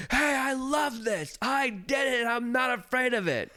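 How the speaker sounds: high, loud and slow, bursting with pride; triumphant shout. Retold or imitated. To the cast members after the ride, a triumphant high shout. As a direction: crow it out like you just conquered the world.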